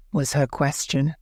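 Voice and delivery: ordinary delivery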